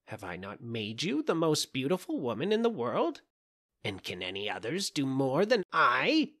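put-on stern scolding old witch. A narrator's reading. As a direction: scold her like a jealous witch. Witch lectures the princess.

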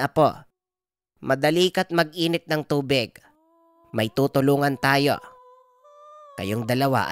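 lightly voiced grandmother taking charge; Lola Bilay, brusque healer. Grandmother healer orders helpers and questions them, brusque. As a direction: give orders like a concerned village healer.

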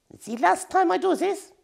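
high squeaky warning voice; little hamster warning her. A narrator's reading. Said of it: "he warned".

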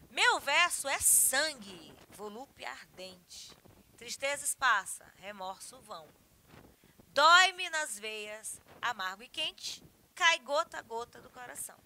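slow, emphatic verse declamation; poem recitation. Acted. (declamação enfática e pausada de poema)

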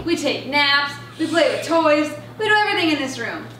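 ordinary delivery

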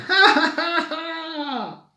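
very high cackle, fading at the end; squeaky acted belly laugh; chick's laughter. A narrator's reading. The little chick bursts out laughing at Grisela, squeaky laughter. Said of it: "se echó a reír".

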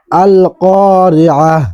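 "আল কারিআহ" ending in a stopped h, slow; pausal form. Shown as a demonstration. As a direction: recite "আল কারিআহ" stopping on the final h. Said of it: "ওয়াকফ করলে উচ্চারণ হবে এইভাবে". ("আল কারিআহ" থেমে হ দিয়ে শেষ)

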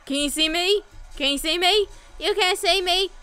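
high, loud sing-out taunt; playful taunting. Pretend. Taunting enemies in the game.

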